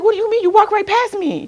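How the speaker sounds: high, loud imitated outrage; incredulous mock protest; indignant confrontation. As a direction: act out an offended friend's challenge. Acted friend's indignant confrontation.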